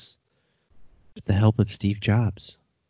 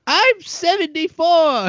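put-on high, laughing old granny; indignant granny through a laugh; grandma voice; 4 dB louder than usual.

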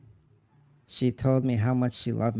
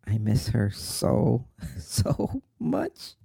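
slow, breaking upward into a sob; sobbing; much slower than usual. Acted. Son confessing to brother he misses Mom, sobbing. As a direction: drag the words out through tears.

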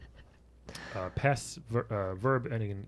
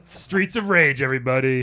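announcer voice; 7 dB louder than usual. Acted. Announcing the title.

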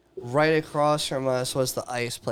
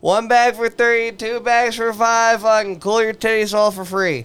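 megaphone sales pitch; 7 semitones higher, 5 dB louder than usual. Retold or imitated. Ice seller calling out to passing women, loud hawking. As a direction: bark it out like a street vendor selling ice. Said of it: "he would yell that".

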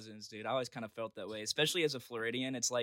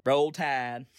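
put-on Alabama cheer; Alabama accent; 3 dB louder than usual. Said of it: "but that is Alabama".

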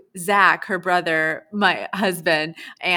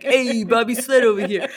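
loud, high mock New York accent; New York Italian accent; 5 semitones higher, 8 dB louder than usual. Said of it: "an Italian".

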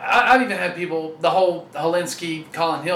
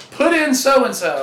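higher, louder heckling fan; imitated fan demand, pitched up and loud; mock fan yell; 6 semitones higher, 5 dB louder, slower than usual. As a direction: call it out slowly like a fan from the stands. Imitating fans in the stands.

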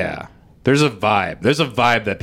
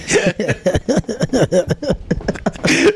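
high, rapid-fire giggle; real high-pitched cackle; long laughing fit; 8 semitones higher than usual. Real, not acted. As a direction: crack up helplessly at your own joke. Cracking up at own joke.

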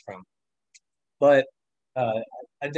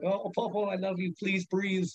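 tender plea; 5 semitones higher, 6 dB quieter than usual. Retold or imitated. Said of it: "I'm like".

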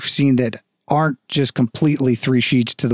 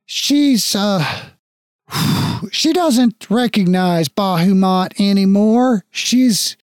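put-on much higher, halting old man; can't bring himself to say it; hesitant father; 6 semitones higher than usual. Father breaking news about the mother to his son, high and halting.

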